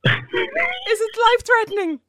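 high, slow hammed-up fearful question; mock panic. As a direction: overplay the scared patient. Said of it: "I'm trying to react here".

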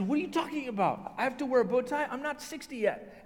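high-pitched, hammed-up disbelief; loud, high mock indignation; incredulous protest. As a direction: protest like an affronted student. Acted protest at wearing bow tie.

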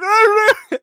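high loud panicked yell; shout. Retold or imitated. Host mimicking the character getting hit, a panicked yell. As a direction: yell it in shocked alarm. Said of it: "He's like".

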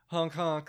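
loud honking clown noises; clown horn honks. Pretend. Joking about clown radio station.

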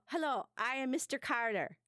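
high, put-on foreign accent; a deliberately bad presidential impression in a foreign accent; Foreign Man accent as Carter. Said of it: "I would like to imitate Mister Carter".

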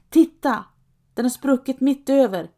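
high, loud call. A narrator's reading. Trapp calling to the others, loud and high. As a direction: shout it out, pointing at the shoe. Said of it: "ropar Trapp med säcken".

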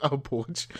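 high, loud exaggerated accent on one word; giggly, over-the-top lawyer accent; fancy Southern lawyer. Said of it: "Huckleberry Finn lawyer type".

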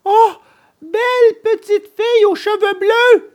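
very high, pleading puppet call; crying out. A narrator's reading. Puppet calling to the vanished blue-haired girl, desperate cry. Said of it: "criait Pinocchio".

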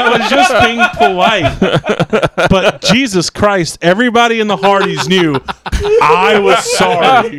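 high, laughing through the words; can't stop laughing as you say it. Real, not acted. Telling podcast friends the Hardee's story, laughing.

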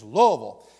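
"Louisville" run together as LOO-vul, punched; Kentucky pronunciation. Shown as a demonstration. Pastor insists on the native way, "Louisville" squashed to LOO-vul. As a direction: hit the first syllable of "Louisville" and swallow the rest. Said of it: "if you're from Kentucky".

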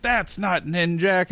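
high, drawn-out objection; mock protest. Comics fan objecting to the screen Ninjak's accent, mock protest. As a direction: protest it slowly, pitched well up.

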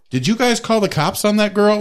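a hint of a guy faking innocence; fake-innocent guy. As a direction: play dumb, acting innocent. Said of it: "And he's like".

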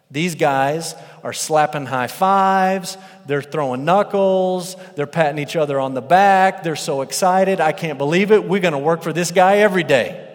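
excited workers. Retold or imitated. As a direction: cheer like you just hit the jackpot. Workers celebrating their big pay.

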